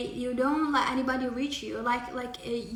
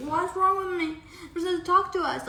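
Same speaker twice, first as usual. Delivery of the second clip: mock indignant colleague; 6 semitones higher, 4 dB louder than usual. Colleague voiced complaining.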